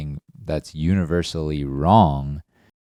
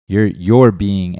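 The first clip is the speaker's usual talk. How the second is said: slow, louder accusing start, stretched out; mock accusing; 3 semitones higher, 7 dB louder, slower than usual. With a friend who won't help, slow and louder, mock-accusing. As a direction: lean in hard on the you're like an accusation. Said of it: "and now I'm attacking their ego".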